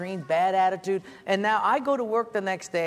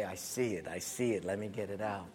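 much lower and softer, gentle; soft careful voice; 11 semitones lower, 12 dB quieter than usual. Retold or imitated. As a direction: murmur like you're peering close. Friend inspecting your eye.

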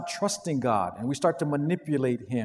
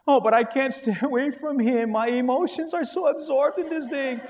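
higher, put-on lovesick voice; lovesick whine; 8 semitones higher than usual. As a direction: whine like a smitten teenager. Acted lovesick believer.

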